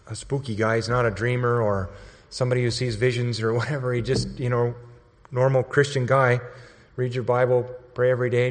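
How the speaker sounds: ordinary delivery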